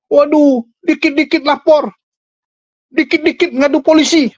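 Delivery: mock the accusers with a high, whiny complaint; mocking whiny imitation. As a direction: whine it like someone always running to the police.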